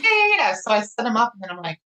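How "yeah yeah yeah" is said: imitating an eager husband, pitched high; quick, high string of yeses; eager agreement. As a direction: play a husband jumping at it. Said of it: "He's like".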